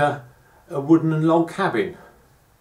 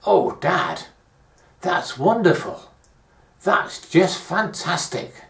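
imagined delight; 5 semitones higher, slower than usual. Retold or imitated. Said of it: "That's what I would have told him".

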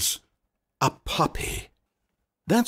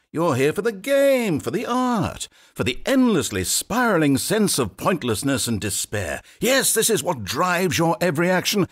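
higher, swelling sarcastic praise; mock-heroic rallying speech; mock grandeur; 7 semitones higher than usual. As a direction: sell pointlessness as noble. Narrator mocks with grand praise.

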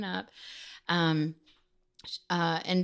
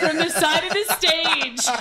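laughing through the words; 8 semitones higher, 3 dB louder than usual. Real, not acted. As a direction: breaks into laughter mid-sentence. Laughing while telling the story.

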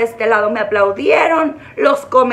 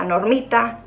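normal talking voice

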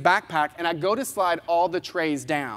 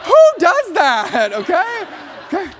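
much higher and louder, slowed, with a laugh; laughing disbelief; 10 semitones higher, 7 dB louder, slower than usual. Real, not acted. Speaker to audience about the agent, loud laughing disbelief. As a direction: marvel out loud at her rudeness.

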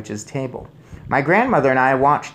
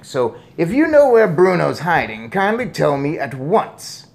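louder, higher, indignant demand; blustering angry father; 4 semitones higher, 5 dB louder than usual. A narrator's reading. Father accuses grandmother of a nasty trick, angry. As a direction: accuse her, loud and put out.

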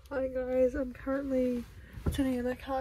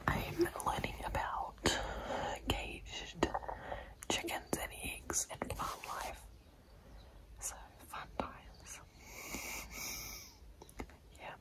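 late-night whisper. Real, not acted.

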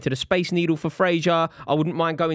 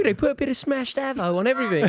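put-on raised-pitch Aussie accent through amusement; higher put-on Aussie twang; Australian accent; 8 semitones higher than usual. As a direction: say it as a jokey imitation Australian. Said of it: "Can you say more than just Big Ange?".

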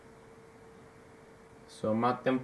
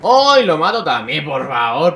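excited shriek; 3 semitones higher, 10 dB louder than usual. Real, not acted. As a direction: yelp with joy at the kill. Another kill, celebrating. (grito eufórico por otra baja)

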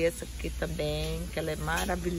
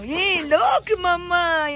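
excited call; 9 semitones higher, 6 dB louder, much slower than usual. Real, not acted. Excited calling out to someone.

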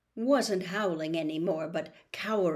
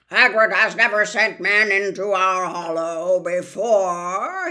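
put-on louder old spider, slow; 8 dB louder than usual. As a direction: weigh each word like an ancient spider. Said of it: "he said slowly".

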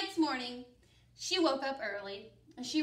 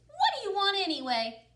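bold high challenge; 8 semitones higher, 6 dB louder, faster than usual. Acted. Old lady questions pumpkin.

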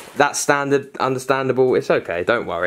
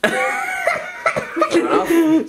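big laugh. Real, not acted. Laughs at Jessica's pause.

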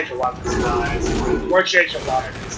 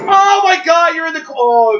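high, loud burst of shock; excited shout; 14 semitones higher, 8 dB louder than usual. Real, not acted. Streamer yelling at a teammate's near miss, excited. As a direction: yelp it out, caught off guard. Shocked at a close gameplay moment.

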